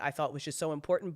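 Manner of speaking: usual speaking voice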